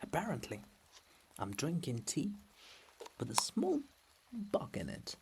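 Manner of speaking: soft whispered ASMR chat; soft whispering vlog aside. Real, not acted. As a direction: whisper gently over the rain. Quiet ASMR-like outdoor aside.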